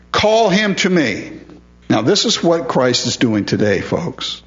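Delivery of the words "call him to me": acted command, pitched up. Preacher voices Jesus summoning the blind man, raised-pitch command. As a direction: issue it as a summons. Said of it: "in a command".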